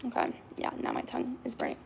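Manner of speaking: ordinary talk, nothing marked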